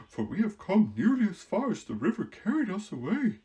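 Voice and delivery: high, loud, lively straw-man voice; Scarecrow voice. Acted. Scarecrow talking to the girl, high and lively. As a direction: play the Scarecrow bright and eager.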